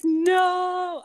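retold shocked no. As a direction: yelp out a disbelieving no. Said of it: "I'm like".

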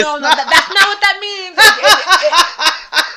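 loud high laughing protest; can't stop laughing while denying. Real, not acted.

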